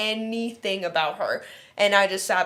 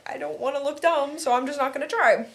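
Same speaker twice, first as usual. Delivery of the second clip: higher, whiny inner voice; a sulky kid giving up; younger self whining; 7 semitones higher than usual. Retold or imitated. To herself after being mocked, higher and sulky. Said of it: "I'd be like".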